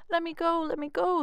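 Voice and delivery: a caught little man yelling to be freed; leprechaun shouting. Acted. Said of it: "the leprechaun shouted".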